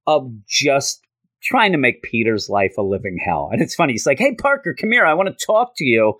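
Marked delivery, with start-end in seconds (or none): 4.2-6.1 higher-pitched, pushy schoolmate calling out; Flash Thompson. Retold or imitated. Student calling a classmate over to talk, lightly acted demand. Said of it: "That's what Flash says".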